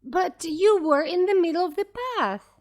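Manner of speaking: put-on high, bright girl protesting; little girl voice. A narrator's reading.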